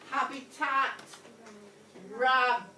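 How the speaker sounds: "rabitat" said loud and clear, r swapped in for h; rhyming demo. Shown as a demonstration. Teacher showing the class a made-up rhyme, loud and clear. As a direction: swap the h of "habitat" for r and announce it loudly. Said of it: "So I'm gonna take r".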